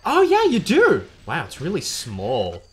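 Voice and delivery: high, drawn-out mock gushing; hammed-up retold surprise, slowing down; mocking barista. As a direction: imitate a condescending barista, swooping up high. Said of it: "And they were like".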